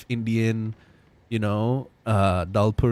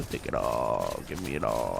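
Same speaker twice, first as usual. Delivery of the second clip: mock monster voice, much lower and softer; deep monster voice; 12 semitones lower, 7 dB quieter than usual. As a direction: growl it like a hungry beast. Greedy curry chant.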